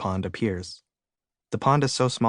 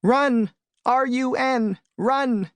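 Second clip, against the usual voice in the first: high-pitched bee scream; 14 semitones higher, 4 dB louder, much slower than usual. A narrator's reading. Bee warning the alien to flee, screaming. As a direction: scream each word high and drawn out. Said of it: "screams one particular bee".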